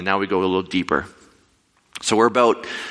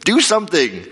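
much higher and louder, frantic pleading; panicked squeak; 11 semitones higher, 4 dB louder than usual. Retold or imitated. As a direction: squeal it in a comic panic at the guide. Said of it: "And I'm like".

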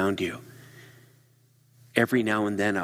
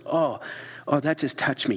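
higher, moved exclamation; lightly acted heartfelt oh; mock touched gasp; 6 semitones higher than usual. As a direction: let it land on you. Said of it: "you just went".

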